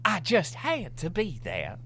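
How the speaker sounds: hammed-up English accent, pitched high; mock English accent. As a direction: draw it out like a prim English lady. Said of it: "You were doing an English accent".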